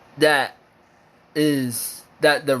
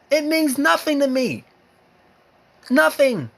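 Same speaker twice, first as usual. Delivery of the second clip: heated outrage; 8 semitones higher than usual. Real, not acted. Ranting at commenters, fed up and climbing high. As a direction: voice climbing high with real anger. Furious rant at people's arguments.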